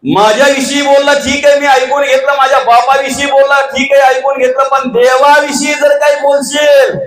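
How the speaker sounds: high shouted warning; furious Angad. Acted. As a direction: roar it at Ravana in defiance. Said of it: "Angad बोलतो".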